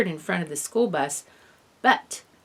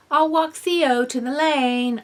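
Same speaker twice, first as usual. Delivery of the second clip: calling out; 7 semitones higher, 5 dB louder than usual. A narrator's reading. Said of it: "I called".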